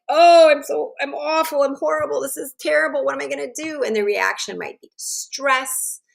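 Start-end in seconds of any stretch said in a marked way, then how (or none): 0.1-3.8 put-on high, loud rush of teen panic; panicked teen. Teen who failed the math test, panicking high and loud. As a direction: spiral into despair over the grade. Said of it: "the feeling is like".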